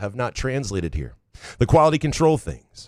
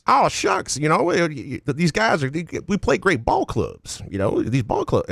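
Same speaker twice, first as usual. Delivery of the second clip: mock Southern coach, starting high; stammering, aw-shucks coach impression with a Southern twang; Jonathan Smith; 4 semitones higher than usual. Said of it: "the Jonathan Smith voice".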